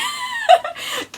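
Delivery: real loud, high burst of laughter; laughing. Real, not acted. Vlogger laughing at calling herself crazy, loud laugh.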